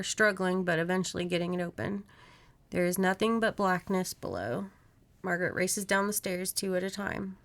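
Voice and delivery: ordinary delivery, nothing marked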